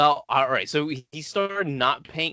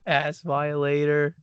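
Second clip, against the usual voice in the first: normal talking voice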